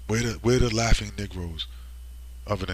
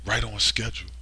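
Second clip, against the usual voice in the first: ordinary talk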